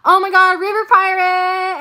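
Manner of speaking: loud, slow, high greeting; excited shout-out. Real, not acted. Streamer greeting a viewer by name, thrilled. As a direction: call out the name with delight.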